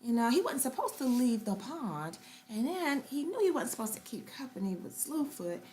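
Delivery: usual speaking voice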